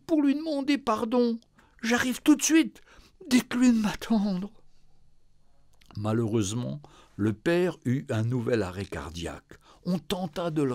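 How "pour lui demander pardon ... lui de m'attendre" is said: high, tearful and pleading; sobbing daughter. Acted. Daughter sobbing to the nurse on the phone, tearful. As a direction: cry out the regret. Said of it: "Elle sanglota de plus belle".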